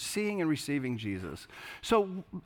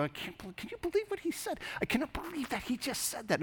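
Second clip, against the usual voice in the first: hushed, higher mock-indignant gossip; gossiping to a friend; 10 semitones higher, 7 dB quieter than usual. Grumbler whispering outrage to the friend beside him, hushed. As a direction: lean in and hiss disbelief to your friend. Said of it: "you go".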